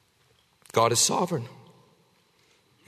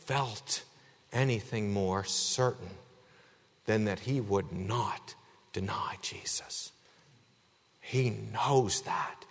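hushed whisper; 9 semitones lower, 10 dB quieter, slower than usual. Acted. Hushed point about Peter's certainty.

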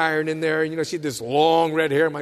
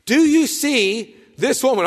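pointed question; 10 semitones higher, 9 dB louder than usual. Retold or imitated. Repeated quote, louder, higher.

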